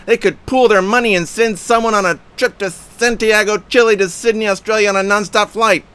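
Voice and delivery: high, loud incredulous rant; shouted outrage at a flat earth comment; going berserk. Retold or imitated. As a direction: read it like you're losing your temper. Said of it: "it was going berserk".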